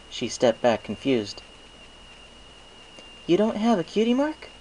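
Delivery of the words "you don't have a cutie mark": high rising disbelief; can't believe what she hears; surprised Twilight Sparkle. A narrator's reading.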